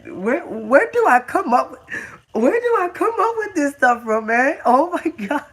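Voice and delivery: high, squealing through real laughter; incredulous laughter. Real, not acted. Host amazed at his own joke, cracking up. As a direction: marvel at it while cracking up, pitch climbing.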